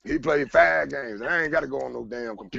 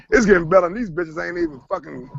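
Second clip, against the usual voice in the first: excited tweet; 11 semitones higher, 6 dB louder than usual. Retold or imitated. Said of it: "I even said it on goddamn Twitter".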